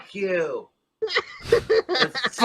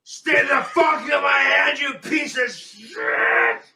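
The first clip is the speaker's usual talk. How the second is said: furious shout; 7 semitones higher, slower than usual. Real, not acted. Man threatening the cigarette in his hand, furious shout. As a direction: threaten it with full fury.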